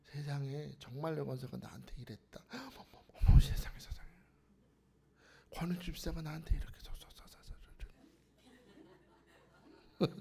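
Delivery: hushed, breathy sputtering in disbelief; mock indignation. Churchgoer aghast at being slighted by another, hushed mock indignation. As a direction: splutter under your breath, comically affronted. Acting out petty offended churchgoer.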